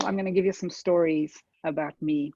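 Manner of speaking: ordinary delivery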